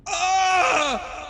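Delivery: high shrieking monster cry; monster scream. Acted. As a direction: scream like a wounded beast. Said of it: "it screams out".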